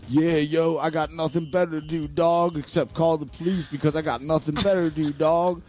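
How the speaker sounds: repeating a taunt at the security guard, mocking sing-song. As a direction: needle him over and over, dog. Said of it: "I just start going".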